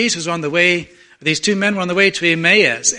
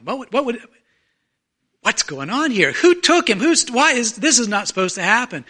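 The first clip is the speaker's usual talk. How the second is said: high-pitched, bewildered questioning; alarmed disbelief; 5 semitones higher than usual. Acted. Mourners finding the grave empty, alarmed disbelief. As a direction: act out baffled protest, pitch jumping up.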